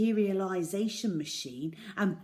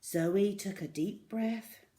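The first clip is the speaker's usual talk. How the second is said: ordinary delivery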